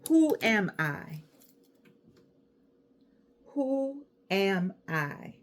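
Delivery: slow, deliberate dictation of the question. Shown as a demonstration. Teacher dictating to the audience, slow and deliberate. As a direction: spell it out slowly for them to write. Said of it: "Right at the top".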